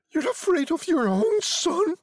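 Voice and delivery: high-pitched woman's outraged question; indignant cry. A narrator's reading. Wife struggling against her husband, high indignant cry. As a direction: challenge him in a very high voice. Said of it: "she cried".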